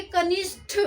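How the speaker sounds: कनिष्ठ ending in an aspirated ठ; correct pronunciation. Shown as a demonstration. Teacher showing the right ठ sound. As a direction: end कनिष्ठ with a breathy ठ, not ट. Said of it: "ठ, कनिष्ठ".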